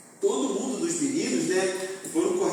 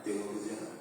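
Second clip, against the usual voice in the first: soft worried mother; 8 semitones lower, 12 dB quieter, much faster than usual. Retold or imitated. Mother voiced, sensing trouble.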